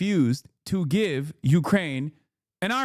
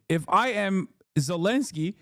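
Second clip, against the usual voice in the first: normal talking voice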